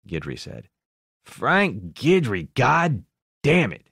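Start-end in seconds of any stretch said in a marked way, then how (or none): 1.2-3.9 slow, higher and louder disbelieving outburst; gruff delighted curse; loud relieved exclaim. A narrator's reading. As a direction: drag out the name in loud disbelief. Ed relieved, recognizing old friend.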